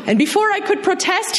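ordinary delivery

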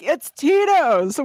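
slow, high, cheering "It's Tito's. Woo!"; excited cheer. Retold or imitated. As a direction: hype up "Tito's" like a fan. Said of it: "They're like".

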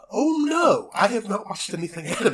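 high alarmed shout; panicked realization. Retold or imitated. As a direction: cry out in sudden dismay.

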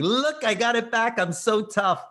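put-on showing off to the girlfriend as a tough guy; mock tough guy. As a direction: swagger back in, pleased with yourself. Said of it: "like I thought I was such a tough guy".

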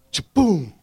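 high loud cha-boom; a laughing crash sound; mock impact. Preacher acting out being knocked down, loud.

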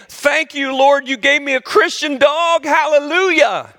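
mock revival joy; hammed-up praise.

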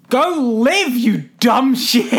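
high, exasperated telling-off with laughter creeping in; mock angry doctor. Doctor orders his dying patient to live, mock exasperation. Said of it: "the doctor's really angry".

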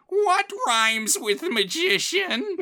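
super high-pitched, drawn-out obnoxious rhymer; screechy-high character imitation, stretching every word slowly; Tom Tit Tot. Co-host outdoing the other's imitation, super high-pitched and slow. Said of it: "imitating his voice. It's like".

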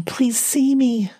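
slow, pleading whisper; a frightened whispered plea; desperate whisper. A narrator's reading. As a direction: beg under your breath to be seen. Pleading silently to passing car.

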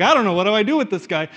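mildly frustrated angel. Acted. Angel calling on his superior about the thief, mock frustration. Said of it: "the angel gets frustrated".

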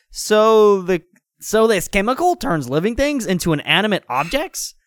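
high-pitched cartoon hero asking a question; Sonic. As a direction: pitch it up, incredulous-sounding character question. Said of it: "asked Sonic".

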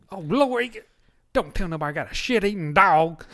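loud, appalled Southern granny; Southern grandma. Retold or imitated. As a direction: exclaim it like a scandalized Southern grandmother. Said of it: "I'll give you an impression of her".

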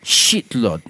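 halting mock-Russian confession; comic Russian convict; Russian accent. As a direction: put on a Russian accent, pausing between phrases. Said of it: "I don't know why he's Russian".

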